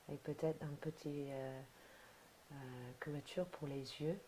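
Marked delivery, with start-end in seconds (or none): none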